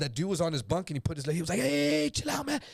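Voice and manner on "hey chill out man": much higher, hurried placating voice; high placating plea. Retold or imitated. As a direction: jump up in pitch to calm the knife guy down. Retold: cellmate backs down.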